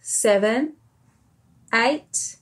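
plain reading voice

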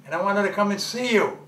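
loud, high-pitched angry insistence; furious, forceful confrontation. Acted. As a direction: raise your voice and lay down the law. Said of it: "you sound like you're angry".

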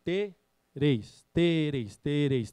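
"tereis" dito devagar, sílaba a sílaba, "te-reis"; speech drill. Shown as a demonstration. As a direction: repete "tereis" como exercício de fono. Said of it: "Em vez de você falar três, fala tereis". (fonoaudióloga ensinando, repetindo "tereis" com calma)

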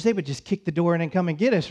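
ordinary delivery

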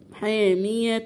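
slow recited "حاميه", the tanween held as meem; iqlab with ghunnah. Shown as a demonstration. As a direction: draw out "حاميه" to a hummed "-tum". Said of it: "احنا جبنا غنة".

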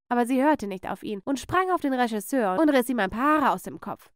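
plain reading voice

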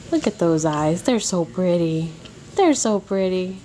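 usual speaking voice

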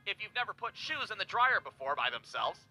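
usual speaking voice

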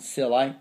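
"silly" said as sill-eye; wrong long I ending. Shown as a demonstration. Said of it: "not silly".

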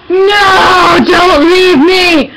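loud, drawn-out mock wail; mock despair. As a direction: wail after the dog, hammed-up. Dog walks away, mock despair.

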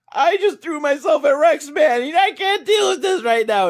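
very high, loud imitation of an overwrought girl; mock distraught outburst. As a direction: wail it out, hammed-up and frantic. Imitating upset girl's rejection.

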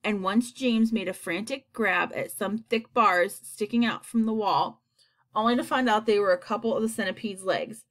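ordinary delivery

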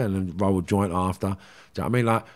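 ordinary talk

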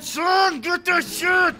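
yelling at the screen, high and loud; shouted hype at the game. Real, not acted. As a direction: scream it as you go for the kill. Hyped gamer yelling at screen.

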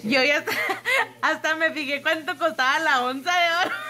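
talking through real giggles; can't stop laughing at yourself. Real, not acted. Mother admitting she checked gold prices, laughing through it.

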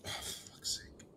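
whispered under the breath in frustration; exasperated whisper. Real, not acted. As the friend refuses on the phone, a real exasperated whisper. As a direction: let it slip out as a fed-up whisper.

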